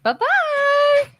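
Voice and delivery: put-on high, sing-song bye-bye to the kids; cheery goodbye. Mom seeing kids off to school, high cheery farewell. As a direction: wave them off brightly. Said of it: "I was like".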